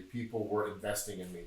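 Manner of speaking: ordinary talk, nothing marked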